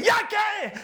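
high, loud startled yell from the wife; a loud high ya qué; wife's shout. Retold or imitated. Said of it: "su mujer sale y le dice".

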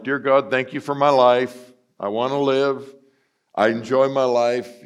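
bright grateful praise; joyful prayer. Retold or imitated. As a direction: sing out thanks each morning.

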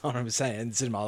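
ordinary delivery

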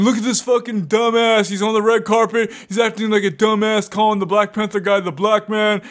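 mocking internet commenters. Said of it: "people on the internet saying".

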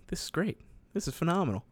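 high but soft delighted quote; retold delight. Recalling his new bank job, softly delighted. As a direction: lift the pitch, keep it soft. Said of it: "and I was like".

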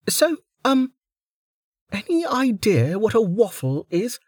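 very high bubbly voice; a cheerful girl babbling apologies; chirpy young woman. A narrator's reading. Niriri apologising to Velika, bubbly and high.

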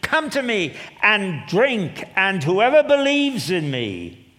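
high, loud, slow cry of invitation; loud proclaiming call. Acted. Jesus at the feast crying out, high and loud. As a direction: call out to the thirsty crowd. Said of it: "said in a loud voice".

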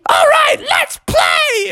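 very high, slow squealed war cry; high-pitched baby shout, words stretched out; excited baby frog. Acted. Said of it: "the baby was like".

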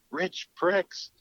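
high, incredulous repeat of Rich pricks, pricks rising sharply; incredulous echo. Retold or imitated. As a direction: throw the insult back in disbelief. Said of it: "I was like".

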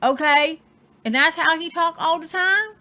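ordinary talk, nothing marked

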